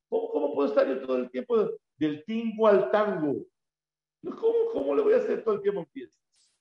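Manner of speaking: high, whiny mock lament of someone overbooked; high-pitched complaining about endless celebrations; exasperated complaint. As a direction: whine like you're stuck at parties all day. Voicing a busy person's complaint.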